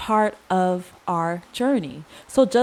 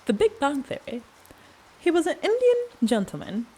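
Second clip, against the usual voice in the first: put-on a light Indian accent, pitched higher; sudden accent; 8 semitones higher, faster than usual. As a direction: slip into a playful Indian accent. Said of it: "I'm not sure where this accent is coming from".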